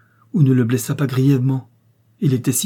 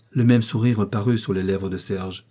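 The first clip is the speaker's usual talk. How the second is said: ordinary delivery, nothing marked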